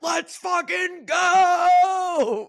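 high, loud, drawn-out war cry; rally the troops before the charge; Braveheart battle cry. Pretend. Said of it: "I feel like Braveheart".

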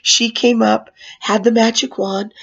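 usual speaking voice

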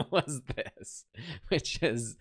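amused laugh breaking into the words; high burst of laughter, then talking through it. Real, not acted. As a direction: laugh through it at a ridiculous result. Laughing at failed man bun result.

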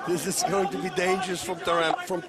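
ordinary talk

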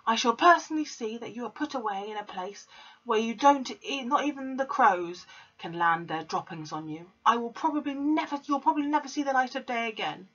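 high, fast headmistress threat; lightly voiced raging headmistress; threatening Trunchbull. A narrator's reading. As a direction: pile the threats on.

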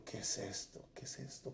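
hushed, breathless questioning; confused whisper. Retold or imitated. Man waking confused after the night vision, whispering. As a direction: whisper it to yourself, bewildered.

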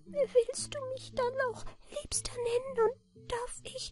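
very high, soft, faint creature voice; weak-voiced little manikin. A narrator's reading. Little man asks to be called dearest, weak voice. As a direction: ask faintly in a tiny falsetto. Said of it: "Mit schwacher Stimme".

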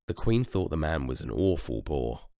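ordinary delivery, nothing marked

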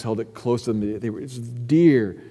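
usual speaking voice

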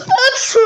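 loud sudden real sneeze; sneezing. Real, not acted. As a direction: let out a big sneeze. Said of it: "Kesinlikle hapşurdum". (hapşırma)

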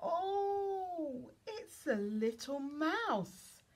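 high, loud, drawn-out reveal; big slow "oh!" of discovery for a small child; delighted surprise. Acted. Reveals mouse under book flap.

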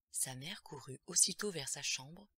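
usual speaking voice

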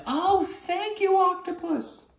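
very high, slow, soft thanks; boy's voice. Acted. Boy thanking his friend, high and slow. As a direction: thank him in a tiny high voice. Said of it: "And the boy says".

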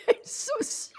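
very high mock-weepy apology; almost in tears. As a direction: squeak it out as if crying. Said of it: "I was almost in tears".

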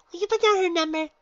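very high, loud bubbly pony voice; Pinky, exclaiming. Acted. Pinky introduces herself to the gym leader, very high and bubbly.

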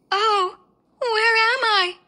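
high startled cry. Acted. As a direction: wake up confused and cry out. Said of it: "she cried".

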